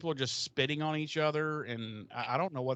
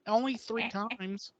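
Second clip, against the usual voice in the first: higher, slow offhand admission; casual Oz; 8 semitones higher, slower than usual. Retold or imitated. Oz playing down the affair to Willow, higher and offhand. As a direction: shrug it off as no big deal. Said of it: "And he's like".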